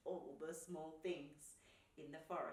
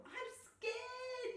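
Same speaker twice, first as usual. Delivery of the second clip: very high frightened squeal; high-pitched fearful cry; scared yell; 4 dB louder than usual. A narrator's reading. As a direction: wail it like a terrified little raccoon. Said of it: "yelled Raccoon".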